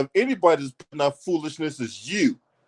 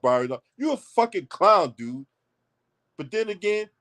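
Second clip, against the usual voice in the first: ordinary delivery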